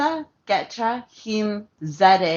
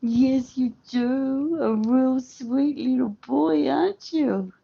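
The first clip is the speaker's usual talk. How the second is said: sweet dog talk; 6 semitones higher than usual. Real, not acted. Owner sweet-talks her husky in a high baby voice. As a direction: sweeten every word for the dog. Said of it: "spoken to, like, really sweetly".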